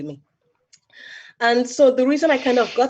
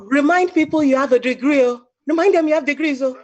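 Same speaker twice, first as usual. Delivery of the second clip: put-on higher, louder Nigerian-accented parent, insistent; 6 semitones higher, 5 dB louder than usual. Daughter recalls her parents scolding her career choice, imitating them with a higher, louder Nigerian accent. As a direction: play the worried parents pressing the point with a Nigerian lilt.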